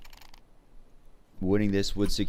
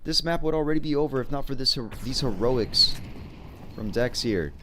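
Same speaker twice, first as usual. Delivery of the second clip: higher and hyped, building to a peak; excited play-by-play; 6 semitones higher than usual. Acted. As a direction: hype up the clutch plays. Play-by-play of a clutch round.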